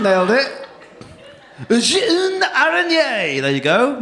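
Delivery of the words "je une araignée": high, stretched mock French accent; hammed-up French. Comic showing off his French, hammed-up French accent. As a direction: draw it out in exaggerated French. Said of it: "You can't just say it in my accent".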